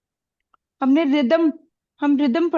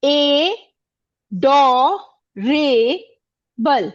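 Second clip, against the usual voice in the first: ए डो रे बल, each syllable alone with pauses; adorable spoken in four separate beats; much slower than usual. Shown as a demonstration. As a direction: break adorable into ए, डो, रे, बल. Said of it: "इसको आप कैसे बोलते हो?".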